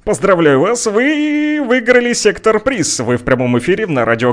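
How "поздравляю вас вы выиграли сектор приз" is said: game show host. Pretend. Host jokes caller won prize.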